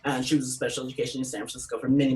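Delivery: ordinary delivery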